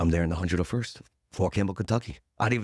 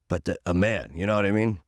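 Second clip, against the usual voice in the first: ordinary talk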